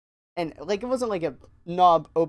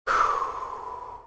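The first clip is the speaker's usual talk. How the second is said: long exasperated exhale; acted sigh. Clerk reacting to his buddy's yelling, acted sigh. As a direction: let out a long, put-upon breath. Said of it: "I'm just like".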